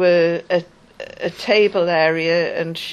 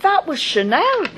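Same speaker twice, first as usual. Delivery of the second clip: indignant protest; 11 semitones higher than usual. Retold or imitated. Woman protests as official bins her Chanel lipstick, high indignant cry. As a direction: blurt it in shocked disbelief.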